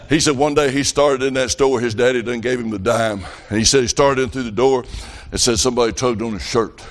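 ordinary delivery

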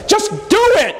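high-pitched exasperated cry of "Just do it!"; exasperated outburst. Retold or imitated. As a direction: fling out "Just do it!" way up high, fed up. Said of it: "the Lord blew up".

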